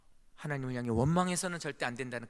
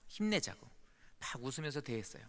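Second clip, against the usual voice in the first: ordinary delivery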